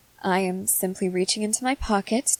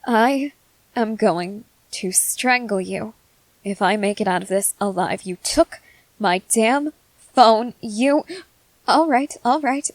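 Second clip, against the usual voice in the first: exasperated anger; 3 dB louder than usual. Acted. Student cursing whoever took the phone, exasperated anger. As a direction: build to an indignant outburst, then hurriedly back off.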